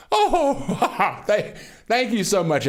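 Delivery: breathless laugh into thank you. Real, not acted. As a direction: laugh out your thanks, overwhelmed. Moved by donations.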